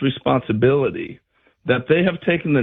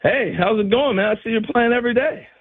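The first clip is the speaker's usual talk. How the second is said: higher, friendly chatter, quick at the end; imitating George Brett; 7 semitones higher than usual. Brett chatting up the rookie on the bag, friendly. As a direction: chat him up from second base. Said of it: "He's a second".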